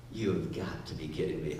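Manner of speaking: put-on muttered teenage disbelief; exasperated. Peter answering Jesus, low exasperated deadpan. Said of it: "He's like".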